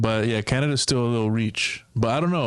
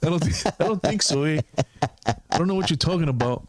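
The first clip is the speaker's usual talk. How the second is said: higher, lightly Canadian put-on; mock Canadian; 5 semitones higher than usual. Mocking a Canadian's denial in a higher Canadian lilt. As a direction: play a Canadian brushing it off.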